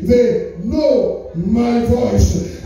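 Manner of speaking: they know my voice, know stretched very slow; stress on know. Shown as a demonstration. Preacher driving the point home, slow stress on know. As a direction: linger on know. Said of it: "what I want to emphasize".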